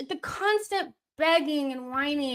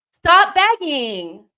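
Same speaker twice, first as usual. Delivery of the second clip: stretched yell; 7 semitones higher, 11 dB louder, much slower than usual. Real, not acted. Repeated yell at players.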